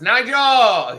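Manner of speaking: high, loud sung-out name call; imitating the guy. Imitating the Dunkin' worker calling out a name. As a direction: belt the name like the quirky manager. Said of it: "the guy that always says".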